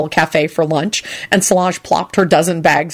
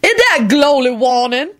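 knowingly; 7 semitones higher than usual. A narrator's reading. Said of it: "Lucretia said knowingly".

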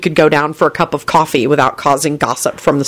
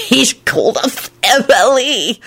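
higher, slow, strained choking voice; choked out; 7 semitones higher, slower than usual. A narrator's reading. Man choking out words to his friend, choked. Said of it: "Lupin choked out".

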